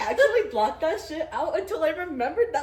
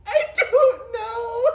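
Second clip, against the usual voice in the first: laughing through tears; 10 semitones higher, 7 dB louder, much slower than usual. Real, not acted. Retelling her own near-tears answer.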